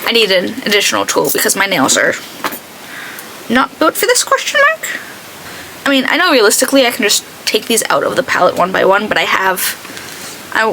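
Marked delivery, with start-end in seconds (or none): 3.5-5.0 mock question. Real, not acted. Mock-confused aside about her nails.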